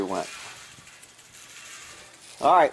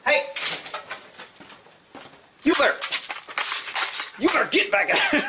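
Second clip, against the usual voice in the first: calling out; 13 semitones higher than usual. Real, not acted. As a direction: order the cat back home. Yelling at cat to return.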